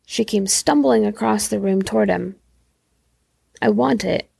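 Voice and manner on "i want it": ordinary delivery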